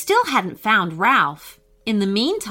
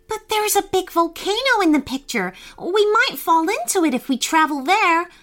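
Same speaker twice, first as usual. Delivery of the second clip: scared; 9 semitones higher than usual. A narrator's reading. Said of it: "cried Brooke".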